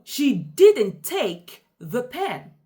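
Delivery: slow, deliberate sentence with "take" stressed. Shown as a demonstration. Teacher showing how stress highlights information, stress on "take". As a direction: lean on "take", drawing the sentence out slowly. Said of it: "I emphasize take".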